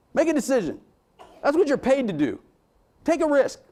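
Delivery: rising exhortation; yelling. Real, not acted. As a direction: push them to act, voice climbing. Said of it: "Sorry, I'm yelling at you".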